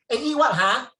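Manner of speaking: high, loud Singlish call; Singlish banter. Acted. Banker in Shenton Way asking a colleague about food, loud Singlish. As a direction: holler it like casual Singlish office chat. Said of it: "you talk like that".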